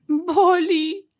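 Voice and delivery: put-on high, loud childish wail of pain; tearful cry. A narrator's reading. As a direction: cry it out as a hurt little badger. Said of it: "zapłakał Borsuczek".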